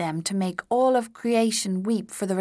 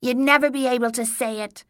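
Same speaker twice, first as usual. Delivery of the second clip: goblin voice; 5 semitones higher, 4 dB louder, faster than usual. A narrator's reading.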